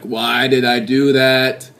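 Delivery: slow, sluggish regretful groan of a question; groggy regret. Retold or imitated. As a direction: drag it out, full of regret. Regret after junk-food binge.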